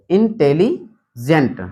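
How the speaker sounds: Intelligent slow, with a clear gap before gent; split in two. Shown as a demonstration. Teacher breaking a word up for students, slow and split. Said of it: "ये Intelli एक हो गया".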